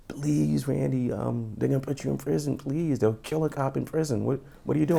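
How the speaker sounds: hushed mock-crying appeal; imitated tearful plea. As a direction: plead quietly, imitating someone upset. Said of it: "she's crying".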